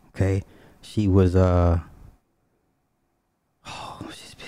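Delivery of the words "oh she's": whisper. Real, not acted.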